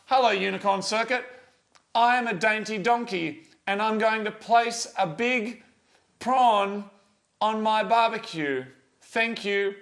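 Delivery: slow, measured dictation with pauses; model sentence. Shown as a demonstration. Host dictating the contest sentence to listeners, slowly and deliberately. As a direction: spell it out phrase by phrase. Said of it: "So it's".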